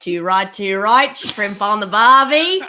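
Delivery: mock Australian accent, hammed up; Aussie accent. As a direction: play a cheesy Aussie for a laugh. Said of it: "Aussie accent, go".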